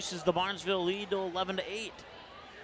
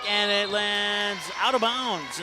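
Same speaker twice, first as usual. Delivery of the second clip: louder, slower, rising call of the ball out; excited call; 3 semitones higher, 4 dB louder, slower than usual. Real, not acted. Announcer calls the out ball to viewers, excited. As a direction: sell the out-of-bounds moment.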